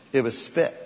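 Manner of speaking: "spit" dropped low and plain; blunt correction. Shown as a demonstration. Pastor correcting the congregation with blunt "spit". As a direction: state "spit" flatly, no frills. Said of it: "You can call it spittle if you want".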